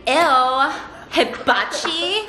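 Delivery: put-on slow, rising teen-girl disdain; mock the cool older sister, dragging out each word; Mean Girls-era teen. Sister rejecting her friend's idea, drawn-out teen-girl disdain. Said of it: "This is, like, how everybody talked back then".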